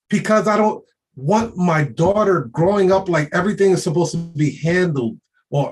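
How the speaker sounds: usual speaking voice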